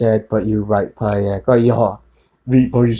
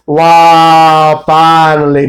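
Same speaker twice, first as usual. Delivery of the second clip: loud exclamation; 5 semitones higher, 7 dB louder, much slower than usual. Real, not acted. Sarcastic exclamation mid-rant.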